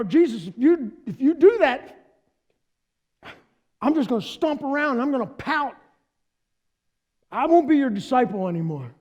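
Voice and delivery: pouting threat. Retold or imitated. Peter sulks and threatens.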